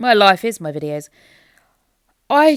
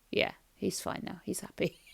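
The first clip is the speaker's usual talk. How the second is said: soft hushed whisper, barely voiced; 13 dB quieter than usual. Real, not acted. Owner whispering to viewers about her sleeping dog, hushed whisper. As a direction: whisper so you don't wake the sleeping dog.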